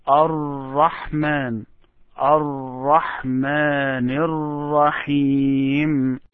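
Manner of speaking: Ar-Rahman with a hard, held double R; hard doubled R. Shown as a demonstration. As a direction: slowly lean on the R as if it were two. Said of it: "and make it harder".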